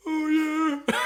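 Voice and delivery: high hammed-up cheer; random dad. Dad at the back of the plane replies, high mock cheer. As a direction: answer from the back like a keen dad. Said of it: "random dad at the back going".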